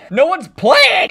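loud, high, drawn-out complaint; frustrated yell. Real, not acted. Gamer finding the game mode empty, frustrated yell. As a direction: wail it slowly and loudly.

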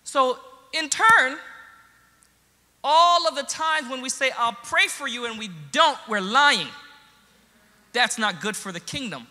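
loud, high, emphatic sermon delivery; impassioned preaching. Real, not acted. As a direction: drive the lesson home to the congregation. Preaches the lesson at force.

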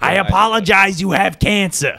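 high, slow mock Italian accent; half Gottfried, half Italian accent; Italian-ish Gottfried voice. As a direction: drag it out like a comic Italian mob voice. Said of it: "That went into, like, an Italian accent".

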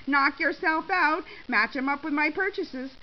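high, loud dare; defiant. A narrator's reading. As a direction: challenge the accuser to check. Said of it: "Shirley said defiantly".

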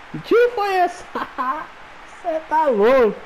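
high, laughing disbelief shout; excited shout. Real, not acted. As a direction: yell in amazed delight. Celebrating a stunning shot.

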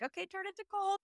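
high, quiet psyching-up; bracing self-talk. Retold or imitated. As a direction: steel yourself to turn it cold.

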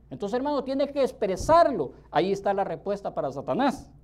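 usual speaking voice

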